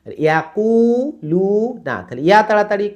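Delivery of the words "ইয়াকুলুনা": higher, slow ইয়াকুলুনা with long কু and লু; two stretches. Shown as a demonstration. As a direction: hold কু and লু, quick final না. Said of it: "কুতে টান".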